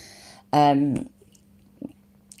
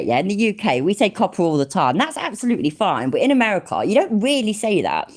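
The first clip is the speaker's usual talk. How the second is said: ordinary delivery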